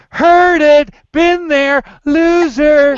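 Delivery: loud, high mocking heckle; heckler. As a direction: shout it down like a rude heckler. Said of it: "and you'll be like".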